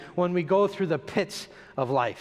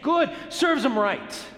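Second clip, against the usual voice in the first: higher, slow smug verdict; smug satisfaction; 6 semitones higher, slower than usual. Acted. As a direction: gloat a little, drawing it out higher. Voicing a scornful onlooker's judgment.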